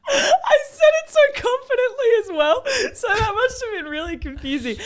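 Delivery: high-pitched, helpless laughter through the words; laughing hard. Real, not acted. Speaker owns up to the confusing word, laughing hard. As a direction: talk through a fit of giggles. Cracking up over the misheard word.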